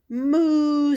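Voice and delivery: loud, long, high moo; cow moo. Acted. Cow revealing itself to the child, loud mooing. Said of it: "says the cow".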